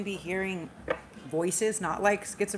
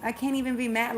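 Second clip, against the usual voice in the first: delighted exclaim; 5 semitones higher, 4 dB louder than usual. Real, not acted. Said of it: "repeated exclamation".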